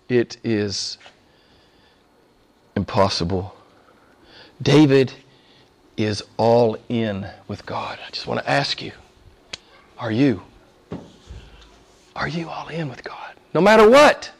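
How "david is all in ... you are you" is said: ordinary delivery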